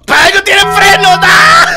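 high, loud shout ending in a scream; angry shout. Real, not acted. Player nearly run over, yelling at the car then screaming. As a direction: yell at the driver then shriek.

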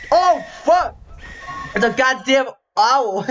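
high-pitched yelp of alarm; startled outcry. Pretend. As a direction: cry out as if suddenly attacked. Startled by an attacking owl.

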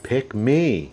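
a light, eager "pick me" for the dog; lightly voicing a keen puppy. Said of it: "hey, you're like".

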